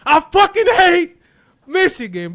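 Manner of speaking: loud, high burst of real hatred; angry outburst. Real, not acted. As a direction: explode with rage at the rival. Heated rant about the rival.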